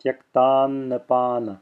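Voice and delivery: Tyaktannapana slowly, humming on the first n; extended first n. Shown as a demonstration. Teacher letting students hear it, extended first n in Tyaktannapana. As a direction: let the first n ring in Tyaktannapana. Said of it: "you hear it as I extend it".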